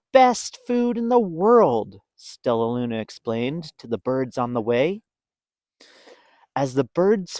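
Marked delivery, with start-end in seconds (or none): 0.1-2.0 raised, louder on the praise; proud boast. Acted. Stellaluna boasting about bat food. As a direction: sell it to the birds.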